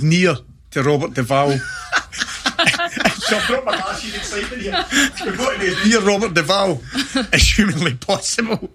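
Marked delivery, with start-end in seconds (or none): 1.6-8.7 giggling through the words, high-pitched; can barely get it out for laughing; laughing while talking. Real, not acted. Telling the host about the film scene, laughing hard.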